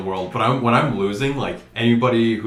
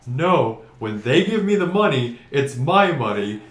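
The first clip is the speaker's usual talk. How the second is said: higher, louder retort to his dad; heated protest; 6 semitones higher, 5 dB louder than usual. Retold or imitated. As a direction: argue back, mildly worked up. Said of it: "I'm like".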